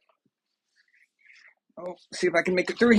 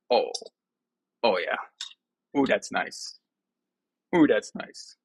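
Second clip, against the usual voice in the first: lower, contented sighs of pleasure; savouring; 5 semitones lower, slower than usual. Real, not acted. Vlogger enjoying his Coke, low pleased sighs. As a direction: relish the first sip.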